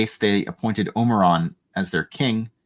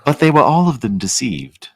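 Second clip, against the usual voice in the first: louder grand narrator voice; dramatic narrator; 4 semitones higher, 5 dB louder than usual. Acted. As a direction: intone it like an epic film trailer. Repeats the line dramatically.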